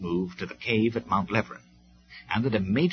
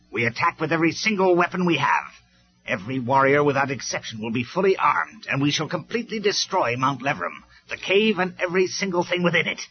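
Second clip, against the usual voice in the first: loud, high ranting orders; war cry; 6 semitones higher, 5 dB louder than usual. A narrator's reading. Megatron ordering every warrior to attack. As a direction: whip the troops into a frenzy.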